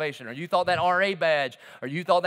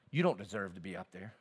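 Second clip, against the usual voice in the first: mock whispers; 8 dB quieter than usual. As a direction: murmur it softly, like nagging inner accusations. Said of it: "begin to give me these whispers".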